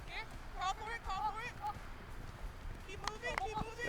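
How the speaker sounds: high shouted encouragement; calling out. Real, not acted. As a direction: yell it across the field. Coaching teammate during drill.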